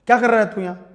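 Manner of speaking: loud, high scolding challenge; imitated angry teacher. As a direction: play the teacher snapping at a student. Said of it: "गुस्सा करते कई बार".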